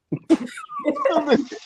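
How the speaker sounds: high-pitched giggle; giggling. Real, not acted. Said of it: "You can't be giggling like that".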